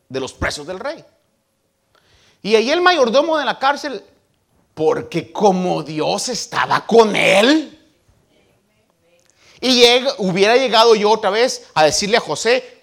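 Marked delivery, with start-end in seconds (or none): 2.4-12.8 loud, high preacher's fervour; full-force preaching. Acted. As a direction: preach it out to the congregation.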